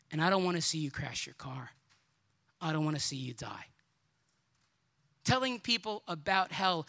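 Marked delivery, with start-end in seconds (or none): 0.1-3.6 tender pleading. Real, not acted.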